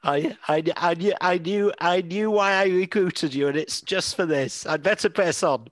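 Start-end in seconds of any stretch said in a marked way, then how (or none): none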